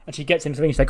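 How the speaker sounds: normal talking voice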